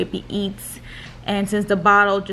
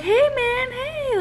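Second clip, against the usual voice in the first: sing-song calls pitched much higher, mimicking the doctor; imitating the doctor calling the baby; slower than usual. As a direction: call the baby playfully in a voice well above your own, as the doctor did. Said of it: "she's like, trying to get his attention".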